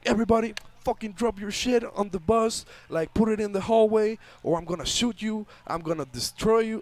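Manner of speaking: shouted stick-up demand; robber yelling. Retold or imitated. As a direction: bark orders like a gunman. Robber threatens bus.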